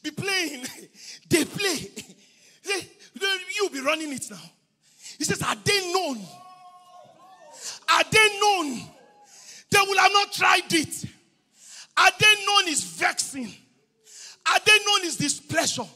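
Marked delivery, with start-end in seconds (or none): 5.4-15.8 insistent, projected repetition; fervent pulpit declaration; full-force preaching. Acted. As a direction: drive each phrase home to the congregation.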